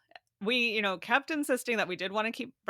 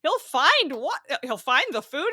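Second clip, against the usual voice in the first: incredulous surprise; 7 semitones higher, 7 dB louder than usual. Retold or imitated. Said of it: "And I was like".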